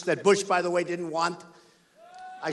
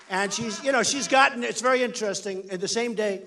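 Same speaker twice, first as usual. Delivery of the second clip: ordinary talk, nothing marked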